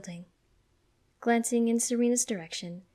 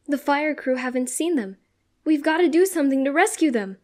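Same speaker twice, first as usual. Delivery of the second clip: urgent plea building to a shout; crying out; 6 semitones higher, 9 dB louder than usual. A narrator's reading. Ash urging Serena to rescue the Pokemon, crying out. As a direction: raise pitch and volume as the urgency mounts. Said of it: "he cries out".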